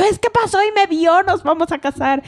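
high, gushing mock excitement; lovestruck raving. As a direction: gush like someone madly in love. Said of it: "yo era muy loca". (enamorada loca, voz aguda)

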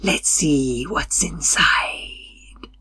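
soft slow whisper. A narrator's reading. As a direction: breathe it, slow and secret. Qualo whispers to Timley.

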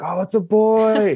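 high, loud, slow delighted call; excited crowd. Retold or imitated. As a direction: draw it out like a cheering crowd. Crowd reacting to scent reveal.

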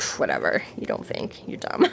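whispered, scoffing put-down; Murphy brushing off Harry's complaint; dismissive mutter. Retold or imitated. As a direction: mutter it off with a pff.

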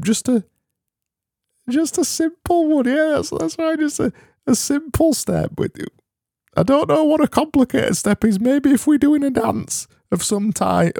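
comic high-pitched wise man voice; very high put-on voice. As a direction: go way up into a tiny squeaky voice. Holds the squeaky voice musing.

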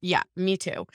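normal talking voice

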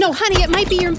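urgent warning. Acted. Rushing to stop husband.

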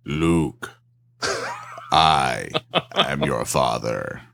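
very deep, slow Vader rumble; deep, breathy, menacing villain; Darth Vader voice. Retold or imitated. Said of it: "you almost reached Darth Vader".

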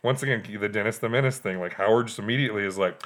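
ordinary delivery

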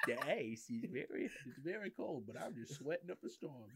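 mock the sweaty memory quietly; silly put-on voice. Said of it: "that fucking voice".

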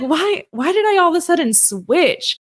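high, exasperated self-questioning; incredulous at yourself; mock bafflement. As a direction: puzzle aloud at your own sudden change. Mock questioning of own voice switch.

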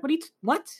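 high-pitched, stammered mock disbelief; incredulous disbelief. Family hears they saw aliens, retold incredulous reaction. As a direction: imitate someone baffled, breaking off mid-word.

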